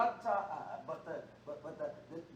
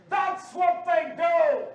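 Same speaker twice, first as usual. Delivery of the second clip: very loud, high, drawn-out roar; full-force shout; 9 semitones higher, 12 dB louder, much slower than usual. Real, not acted. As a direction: thunder each word slowly at the congregation. Repeats it louder, higher.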